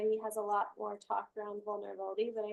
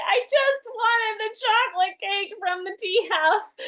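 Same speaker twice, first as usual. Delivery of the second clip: high, loud, drawn-out wail; hammed-up distraught whine; mock emotional breakdown; 11 semitones higher, 11 dB louder than usual. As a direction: wail it like a friend melting down. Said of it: "my friend is having an emotional breakdown".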